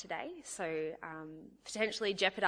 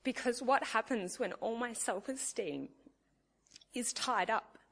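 holding back tears; 4 semitones higher than usual. Real, not acted. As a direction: voice tight and higher, near tears. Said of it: "I didn't think I'd cry".

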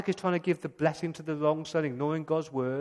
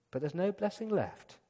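ordinary delivery

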